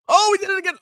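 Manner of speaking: high, thrilled cry; excited. Real, not acted. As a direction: squeal again at the milestone. Bitcoin crosses seventy K again.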